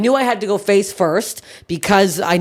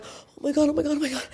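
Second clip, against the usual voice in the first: softer, higher hushed panic; mock panicked; 6 semitones higher, 9 dB quieter, faster than usual. Retelling the manager watching the crowd surf, mock panicked. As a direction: imitate the manager fretting. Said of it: "like, super panicked".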